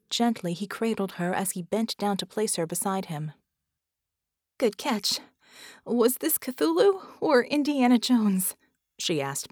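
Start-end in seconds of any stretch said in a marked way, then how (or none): 4.6-8.6 slow, out of breath after the fall; panting. A narrator's reading. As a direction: gasp the words between breaths.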